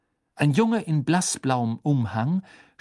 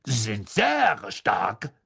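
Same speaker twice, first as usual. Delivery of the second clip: slow stately lady; slower than usual. A narrator's reading. Madame Maxime warns.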